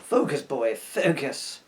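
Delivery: usual speaking voice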